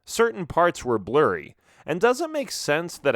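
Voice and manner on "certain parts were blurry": ordinary delivery, nothing marked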